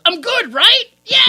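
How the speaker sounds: loud, high, over-the-top boast; mocking Billy at full yell; cocky mock shout. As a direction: holler it, hamming up the self-satisfaction. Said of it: "and Billy goes".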